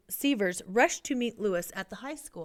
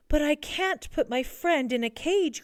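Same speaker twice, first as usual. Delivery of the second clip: higher-pitched, mildly upset protest; distressed cry; 6 semitones higher than usual. A narrator's reading. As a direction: cry out in protest, gently hammed-up. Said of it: "cried Lewis".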